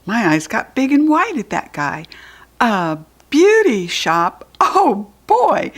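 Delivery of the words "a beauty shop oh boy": loud, slow, then a high squeal; thrilled surprise; excited. A narrator's reading. Judy B squealing at hearing about the beauty shop.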